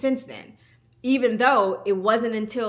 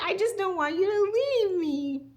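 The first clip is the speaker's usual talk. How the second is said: put-on little girl voice; 13 semitones higher, 4 dB louder than usual. Daughter voiced in story.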